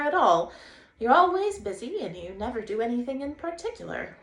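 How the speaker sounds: bright, high and loud Water Rat; cheerful Rat calling a greeting; a lively riverbank rat hailing a stranger; Rat's voice. Acted. Rat's enthusiastic speech.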